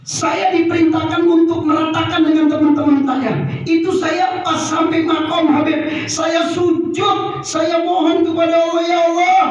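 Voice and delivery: high-pitched imitation of a desperate plea; retold tearful plea. Officer tells the Habib how he begged God, acted tearful plea. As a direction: wail it out high, as a man begging God. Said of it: "dia nangis".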